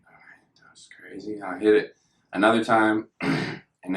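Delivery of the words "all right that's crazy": very soft, half-whispered reaction; retold quiet reaction to a first hit; hushed aside. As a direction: barely voice it, under the breath.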